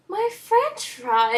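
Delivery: high, drawn-out wail over lost food; mock dismay. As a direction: wail it slowly in mock tragedy. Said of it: "And I was like".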